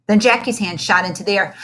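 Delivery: ordinary delivery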